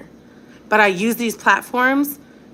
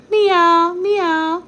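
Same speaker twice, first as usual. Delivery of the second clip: put-on high, slow cat meows for a toddler; 10 semitones higher, 3 dB louder than usual. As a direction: play the kitty for the baby. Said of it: "teaching toddler letters".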